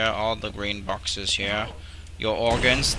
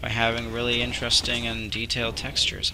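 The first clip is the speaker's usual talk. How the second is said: ordinary talk, nothing marked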